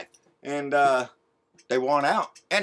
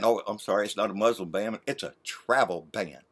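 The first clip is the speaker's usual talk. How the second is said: sarcastic; 10 semitones lower, 9 dB quieter than usual. Real, not acted. Sarcastic self-correction.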